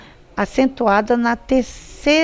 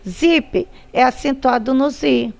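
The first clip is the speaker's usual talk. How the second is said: ordinary talk, nothing marked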